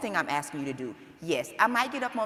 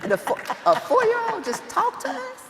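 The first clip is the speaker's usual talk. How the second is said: much higher, incredulous; can you believe this kid; amazed disbelief; 3 dB louder than usual. Retold or imitated. Mother recalling her four-year-old's advice, incredulous and much higher.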